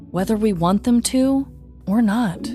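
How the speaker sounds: ordinary talk, nothing marked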